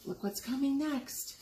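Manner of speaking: usual speaking voice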